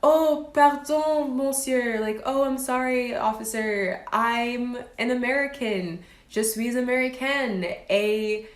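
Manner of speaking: put-on high, pleading American accent in French; innocent American tourist. Aunt sweet-talking the French police, in a mock American accent. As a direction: play the lost American tourist, mock apologetic. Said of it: "she'll be like".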